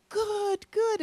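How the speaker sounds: very high praise voice; cooing praise for the kitty; praising the cat. Retold or imitated. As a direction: praise her like a proud pet owner. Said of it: "I was like".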